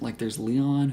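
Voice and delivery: usual speaking voice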